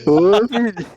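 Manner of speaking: loud call to wake a sleeper. Real, not acted. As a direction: shout his name across the room. Friends shouting to wake Vini.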